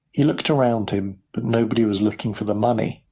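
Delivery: ordinary delivery, nothing marked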